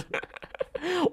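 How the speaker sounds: breathy high-pitched giggle; soft giggle fit. Real, not acted. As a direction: let out a squeaky laugh. Laughing at own joke.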